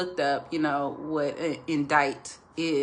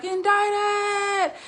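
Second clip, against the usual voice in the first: frantic high shriek of Indicted; hysterical Indicted; 3 dB louder than usual. Retold or imitated. Dick running from his house, shrieking high in panic. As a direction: yell it like a man in total panic. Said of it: "I would say it the way he said it".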